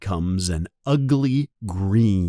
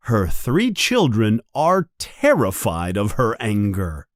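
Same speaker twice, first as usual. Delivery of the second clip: ordinary delivery, nothing marked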